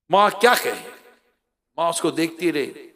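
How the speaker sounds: ordinary talk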